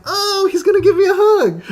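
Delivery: high, laughing mock delight; giddy retold exclamation; mock excitement. Retelling his own excited misreading.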